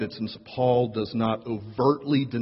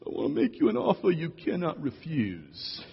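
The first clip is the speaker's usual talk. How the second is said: put-on higher-pitched Sicilian mob boss accent; 5 semitones higher than usual. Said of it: "saying in a Sicilian accent".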